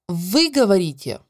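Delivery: usual speaking voice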